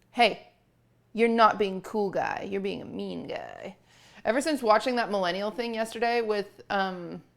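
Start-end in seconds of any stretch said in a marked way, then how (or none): none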